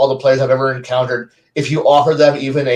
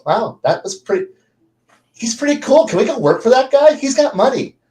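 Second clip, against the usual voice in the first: much higher, starry-eyed player voices; impressed players; 9 semitones higher than usual. Retold or imitated. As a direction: gush like dazzled adventurers. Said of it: "And most players go".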